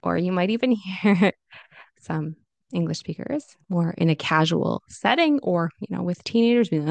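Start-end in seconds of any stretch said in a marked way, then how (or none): none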